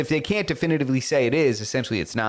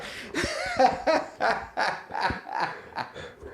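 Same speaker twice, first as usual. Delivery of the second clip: high laugh; 11 semitones higher, 7 dB quieter than usual. Real, not acted. Laughs after Irish bit.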